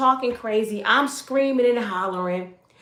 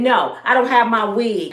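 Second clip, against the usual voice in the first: mad retort; 3 semitones higher, 7 dB louder than usual. Retold or imitated. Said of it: "I'm mad now".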